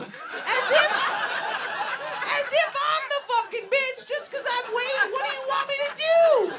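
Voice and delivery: high-pitched indignant rant; worked-up, high comic outrage; exasperated outrage. Acted. Acted exasperated rant at flight attendant.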